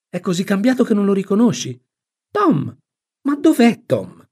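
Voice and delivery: high-pitched, incredulous questioning; bewildered aunt. Acted. Aunt asks her sister where Tom is, bewildered. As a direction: ask high, in growing bewilderment. Sally puzzled, looking for Tom.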